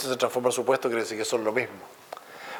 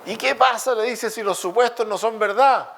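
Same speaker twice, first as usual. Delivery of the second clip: much higher, louder cartoonish challenge; high mock voice; 10 semitones higher, 4 dB louder than usual. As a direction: push back in a squeaky voice. Acted dialogue of philosophers.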